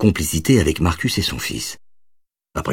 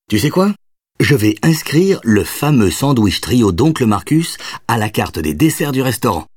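joking; 6 semitones higher than usual. A narrator's reading. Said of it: "plaisanta Jonathan".

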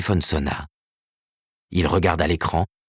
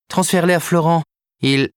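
higher and louder, brushing him off; Karen, dismissive; 11 semitones higher, 5 dB louder than usual. Acted. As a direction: wave the request away.

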